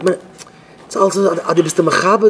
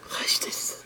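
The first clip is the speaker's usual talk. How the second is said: very soft whisper; hushed, whispered aside; whispered question; 15 dB quieter than usual. Acted. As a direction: ask it under your breath, barely audible. Acted aside amid mimicked greetings.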